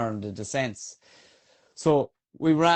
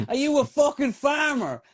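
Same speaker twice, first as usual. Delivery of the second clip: incredulous shout; 13 semitones higher than usual. Retold or imitated. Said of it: "And I said".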